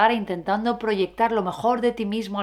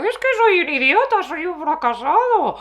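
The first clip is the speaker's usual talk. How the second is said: much higher, louder silly voice; high cartoon voice; 7 semitones higher, 4 dB louder than usual. Shown as a demonstration. Mocking his own negative thought in a cartoon voice. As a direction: squeak it like a cartoon. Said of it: "Para empezar a hablar así".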